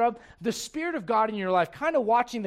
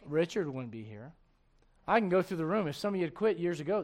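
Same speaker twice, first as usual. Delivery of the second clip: normal talking voice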